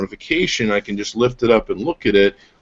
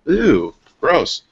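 higher-pitched mild "ew" of disgust; 7 semitones higher than usual. Retold or imitated. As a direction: react grossed out by something sticky. Acts out disgust at smudged camera.

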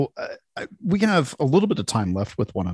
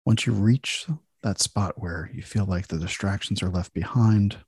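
guided meditation. Acted. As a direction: ease listeners into calm relaxation.

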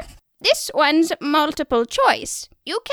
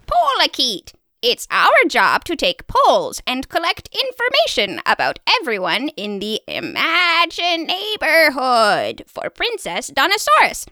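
ordinary talk, nothing marked

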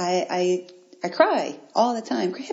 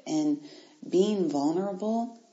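ordinary talk, nothing marked

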